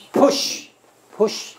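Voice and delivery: push，push breathed out with no voice; teacher modelling push as air only; breathy demo. Shown as a demonstration. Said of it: "安得好".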